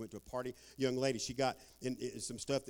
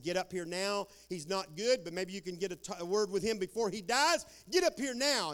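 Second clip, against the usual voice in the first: urgent call; 6 semitones higher, 7 dB louder than usual. Retold or imitated. Hospital urgently calls dad.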